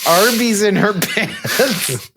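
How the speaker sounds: slow, giggling delivery; barely holding it together, laughing; laughing through words. Real, not acted.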